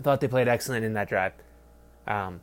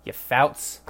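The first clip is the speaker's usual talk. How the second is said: mock Yiddish insult. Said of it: "Fouts is Yiddish".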